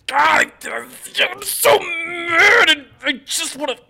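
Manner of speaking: loud, high, strained outburst; hammed-up angst; mock teenage frustration. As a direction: draw it out slowly like an exploding teenager. Acts out frustrated lonely teenager.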